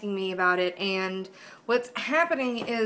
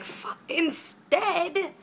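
slow, drawn-out incredulous higher pitch; mock disbelief; 8 semitones higher, much slower than usual. Retelling her reply to the roommate, mock disbelief. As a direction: stretch out the disbelief. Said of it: "And I'm like".

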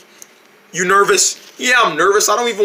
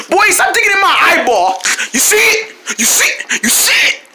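annoyed yelp; 9 semitones higher than usual. Acted. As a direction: protest in pain. Friend poking his eye.

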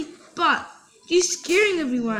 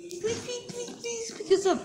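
high pleading; 7 semitones higher, 8 dB quieter than usual. Acted. Kids begging parent on phone.

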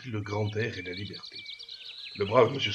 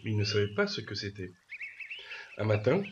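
ordinary delivery, nothing marked

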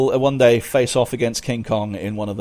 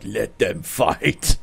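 deadpan Japanese actor; much slower than usual. Retold or imitated. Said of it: "he's just like".

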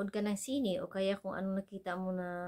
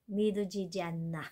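fast rattled-off phrase; much faster than usual. Shown as a demonstration. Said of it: "It's unbelievable".

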